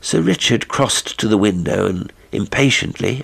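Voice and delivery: usual speaking voice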